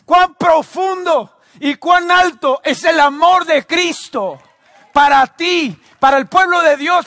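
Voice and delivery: high, shouted pulpit climax; impassioned shouting to the congregation; full-force preaching. Real, not acted. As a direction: cry out each phrase in mounting waves.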